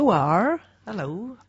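West Country "Ooh, are" drawn out; rustic West Country greeting; West Country accent. Pretend. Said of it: "seriously gonna try a West Country accent".

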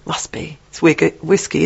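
ordinary talk, nothing marked

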